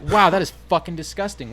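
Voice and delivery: loud, high cry of disgust; disgusted outburst. Retold or imitated. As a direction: recoil from the taste out loud. Retelling tasting the roll.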